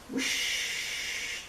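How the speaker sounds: whispered whoosh. Acted. Train sound.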